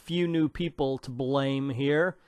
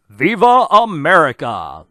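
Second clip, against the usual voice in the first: loud, hammed-up battle cry; big theatrical proclamation; mock rallying cry; 9 dB louder than usual. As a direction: belt it out like a slogan. Reading the heroine's rallying cry.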